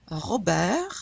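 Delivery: "Robert" as French ro-BEHR, rising end; French "Robert" with throaty R and no T; silent final T. Shown as a demonstration. Teacher reviews endings, French "Robert" ro-BEHR. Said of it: "the only consonants that we generally pronounce".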